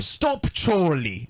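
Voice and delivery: slow, drawn-out mock British; exaggerated British impression; fake-sounding British accent. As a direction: stretch it out in a parody British accent. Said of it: "It was that accent".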